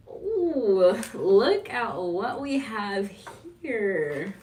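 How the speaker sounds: high, loud, drawn-out ooh of delight; excited discovery. Real, not acted. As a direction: gasp with delight at a find. Finds her love list on camera.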